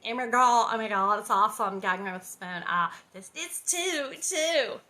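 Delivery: put-on loud valley girl gushing. Reader imitates a valley girl gushing, loud. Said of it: "like, a valley girl".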